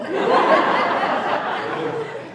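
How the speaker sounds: high, loud burst of laughter; big real laugh. Real, not acted. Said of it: "But now it gets funny".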